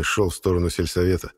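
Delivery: ordinary delivery, nothing marked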